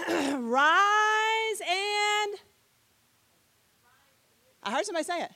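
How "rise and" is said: high, loud, long-held words; drawn-out ringing call; scripture declamation. Shown as a demonstration. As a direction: proclaim it, stretching each word out. Prompts class to quote scripture.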